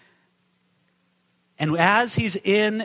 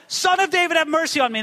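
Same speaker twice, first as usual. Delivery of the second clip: high, urgent plea; acted beggar's cry; 8 semitones higher, faster than usual. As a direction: call out for mercy, pitched well up. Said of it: "And he cries out".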